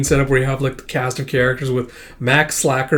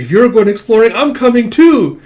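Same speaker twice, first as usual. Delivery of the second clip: high, loud and insistent; eager child; 8 semitones higher, 7 dB louder than usual. Acted. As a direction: demand to come along on the adventure. Child insists on joining exploration.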